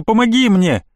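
high pleading cry; high frightened plea. Acted. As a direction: beg for help in fear. Boy begging for help.

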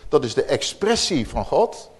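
usual speaking voice

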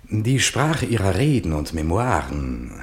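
höher und lauter, schwärmerisch zitiert; Malraux zitiert. Retold or imitated. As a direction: den Minister voller Pathos zitieren. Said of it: "hatte Malraux zu ihm gesagt". (lauter, höher gesprochenes Malraux-Zitat)

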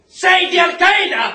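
furious shout. Acted. Interrogator shouting the same question at the prisoner. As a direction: force the answer out of him.

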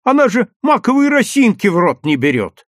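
high, loud exasperated complaint; vexed woman's outburst; irritated shouting. A narrator's reading. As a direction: argue loudly and indignantly. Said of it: "раздражённо кричала Исфирь Яковлевна".

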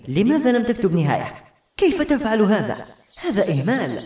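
high-pitched indignant scolding; very exasperated. A narrator's reading. Girl scolds the writer for leaving no ending, exasperated. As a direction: demand an explanation, pitch jumping up in outrage. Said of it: "قالت بحنق شديد".

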